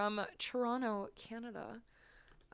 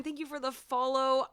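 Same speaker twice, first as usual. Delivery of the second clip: ordinary delivery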